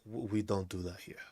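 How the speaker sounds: hushed, disapproving squirrel. Acted. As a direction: mutter it like a prim squirrel. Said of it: "the squirrels is like".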